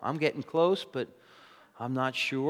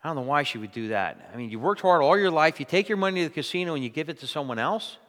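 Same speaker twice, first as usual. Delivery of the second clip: normal talking voice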